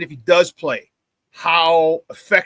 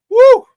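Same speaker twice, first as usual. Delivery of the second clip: high, loud whoop; fired-up woo; 11 dB louder than usual. Real, not acted. Host whooping because he is fired up about tomorrow. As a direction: let out a hype whoop. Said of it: "I am fired up".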